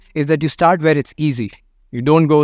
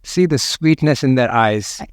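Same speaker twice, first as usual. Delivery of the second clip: guided meditation. Acted. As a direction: invite them to feel it, unhurried.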